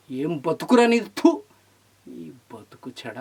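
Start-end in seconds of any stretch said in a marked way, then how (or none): 1.1-1.4 a sharp, high spit of disgust; spitting thoo. Real, not acted. Spits in disgust. 2.1-3.2 low, much softer and slow; quiet, slow contempt; muttered disgust. Real, not acted.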